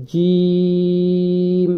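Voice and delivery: "জিম" with mid-tongue pressed firmly to palate, long drawn vowel; firm jim. Shown as a demonstration. Teacher showing how jim is pronounced, firm and long-drawn. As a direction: hold "জিম" out slowly, hard J from mid-tongue. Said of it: "জিহ্বার মধ্যখানটা শক্ত করে".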